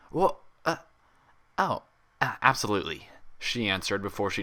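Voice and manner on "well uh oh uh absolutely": higher, hesitant stammer; flustered Twilight. Acted. Twilight flustered when Applejack asks her, stammering. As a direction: caught off guard, stumbling.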